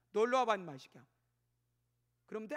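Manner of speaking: loud angry summons that drops off at the end; angry parent. Retold or imitated. Angry parent calling child over, loud scolding. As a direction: call the kid over in a loud temper. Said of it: "본인 막 화나고 승질나고".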